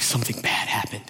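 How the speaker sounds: imitating a panting kid; low, soft, breathless report; out of breath. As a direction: gasp out bad news as if winded. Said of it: "they're out of breath, panting".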